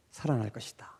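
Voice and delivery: hushed whisper, barely voiced. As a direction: breathe it out like a secret. Jesus foretelling his own death.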